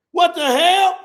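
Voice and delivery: loud, high shocked exclamation; shocked what the hell. Retold or imitated. Comic acting out his shock at the crowd, loud exclamation. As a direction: blurt it out in disbelief.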